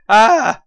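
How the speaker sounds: a sudden loud high "Ah!"; frustrated yell. Real, not acted. Chess player reviewing his messy endgame, exasperated loud cry. Frustrated at chaotic queen endings.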